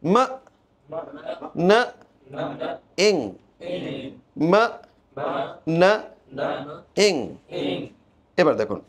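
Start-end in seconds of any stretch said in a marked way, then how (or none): 0.0-7.1 short spaced মা, না, ইং with air out the nose; nasal sounds. Shown as a demonstration. Teacher demonstrating nasal consonants, isolated sound drill. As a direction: hum মা, না, ইং through the nose. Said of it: "nasal sound, মানে নাক দিয়ে".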